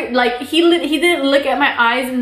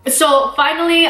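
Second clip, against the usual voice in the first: normal talking voice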